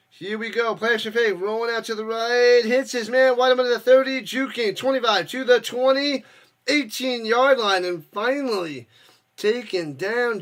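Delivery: announcer swept up in a big gain; play-by-play building. Real, not acted. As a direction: build the excitement with each yard. Live play-by-play of a game.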